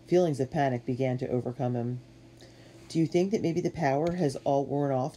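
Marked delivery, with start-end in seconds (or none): none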